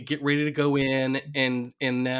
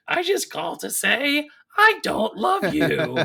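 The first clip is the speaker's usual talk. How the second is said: high falsetto impression of a phony mother; hammy, badly faked old lady voice; Homer's fake old lady; 10 semitones higher, 4 dB louder than usual. Co-host replays Homer's fake mother line, high falsetto old lady. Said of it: "doing this fake old lady's voice".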